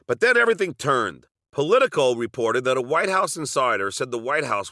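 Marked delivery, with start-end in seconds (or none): none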